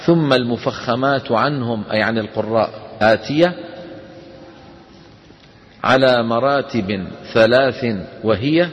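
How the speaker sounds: slow measured chanting of the poem; verse recitation. Shown as a demonstration. Teacher reciting a tajweed poem to students, slow chant. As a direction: recite the verse slowly and evenly.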